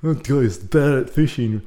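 doofus cartoon teen with a dumb chuckle; Beavis and Butthead. Birdwatcher joking to strangers, cartoon doofus impression. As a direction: chuckle dopily through the joke. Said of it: "I made a Beavis and Butthead joke".

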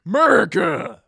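loud, high "America!"; patriotic shout. Pretend. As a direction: bellow it like a proud patriot. Mock patriotic cheer.

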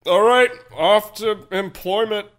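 slow, loud grand exit; mock heroic. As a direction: declaim it like a hero departing.

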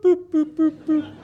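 high electronic beeps, repeated; high boops. Retold or imitated. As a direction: mimic blinking lights, high. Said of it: "blue lights would go boop".

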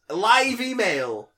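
slow, drawn-out hype; hype announcement. Pretend. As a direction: announce it like a big event. Hyping the email segment.

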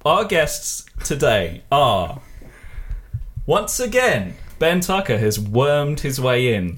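slow, high announcer build; announcer introduction. Acted. Podcast host introducing the guests, drawn-out announcer delivery. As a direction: draw out the guest introduction grandly.

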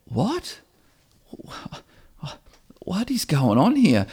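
a shaken disciple who can't take it in; stunned disbelief. Retold or imitated. Disciples hearing of coming death, stammering in shock. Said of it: "Now they're confronted with".

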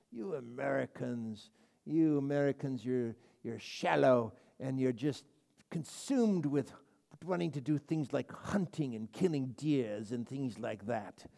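haughty put-on English accent, scolding; mock English accent. An imagined Englishman mocking Americans in a haughty English accent. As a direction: look down your nose in an English accent. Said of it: "I would speak to you in an English accent".

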